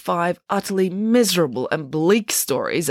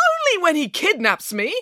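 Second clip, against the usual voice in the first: high-pitched protest; arguing back; 12 semitones higher than usual. A narrator's reading. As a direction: argue the point, pitch jumping up. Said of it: "argued Nanny Piggins".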